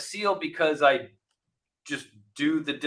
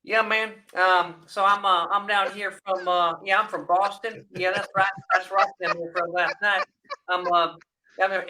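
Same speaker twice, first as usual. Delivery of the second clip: fake Boston accent; 7 semitones higher than usual. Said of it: "the guy'd be like".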